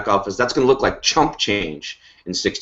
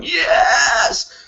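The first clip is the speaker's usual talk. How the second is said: a much higher, thrilled shout of "Yes!"; imitated excited yes. Host imitating a thrilled teammate, high shouted cheer. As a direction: squeal it like a fan cheering. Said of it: "I know Dean is watching this going".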